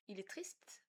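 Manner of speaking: whispered il est triste. Real, not acted. Player whispers that the sad monster is upset. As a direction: breathe it with no voice.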